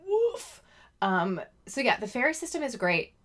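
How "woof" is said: squeaky little woof bark; high playful woof. Pretend. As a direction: yip it like a small dog. Echoing woof back.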